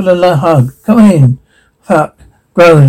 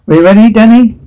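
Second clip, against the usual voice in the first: higher, rousing call to a companion; rally Danny with a raised voice; 6 semitones higher, 4 dB louder than usual. Acted. Rallying Danny before setting off.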